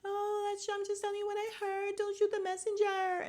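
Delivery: high, overacted innocent act; high-pitched mock-dramatic protest; hammed-up acting. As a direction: put on a bad little acting performance. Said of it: "her best little acting performance".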